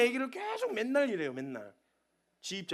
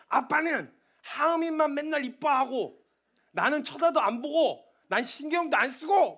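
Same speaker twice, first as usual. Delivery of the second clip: put-on higher, slow whiny little boy; sulky child; 4 semitones higher, 5 dB louder, slower than usual. Five-year-old son complaining to dad, whiny and slow. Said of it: "막 이러면서 이런 얘기를 해요".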